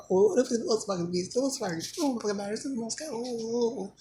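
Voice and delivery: high, whiny mock voice of the people arguing with him; mock the arguers in a high whine; mocking complainer. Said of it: "Complaining and shit".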